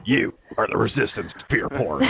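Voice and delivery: throat-straining mock-menacing deep voice; deep put-on voice. Host performing a joke line for friends, deep put-on voice. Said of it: "You do that way too good. That hurts my throat".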